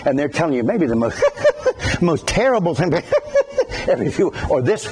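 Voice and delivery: words broken by little laughs; laughing while talking. Retold or imitated. Preacher imitating people who laugh while talking, giggling through words. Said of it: "they laugh while they're talking".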